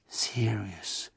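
a soft, low murmur into the dark. A narrator's reading. Harry murmuring Sirius's name into the night, soft. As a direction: barely voice the name. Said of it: "murmelte Harry".

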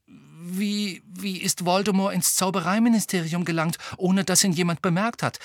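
high, hesitant, anxious question; rather fearful. A narrator's reading. Hermine asks how Voldemort got in, anxiously. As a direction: start timidly, then press on with worry. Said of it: "mit ziemlich ängstlicher Stimme".

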